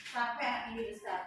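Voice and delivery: retold bedtime worry; quiet private prayer. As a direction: ask it low, as if alone at night. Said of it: "saya ngomong gini".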